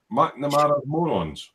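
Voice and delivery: ordinary delivery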